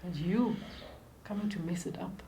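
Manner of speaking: ordinary delivery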